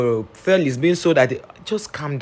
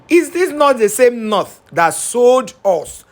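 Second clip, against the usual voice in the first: slow, loud, high outraged question; slow indignant outburst; 9 semitones higher, 5 dB louder, slower than usual. Real, not acted. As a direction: hurl it out in disbelief. Outraged rhetorical question about north.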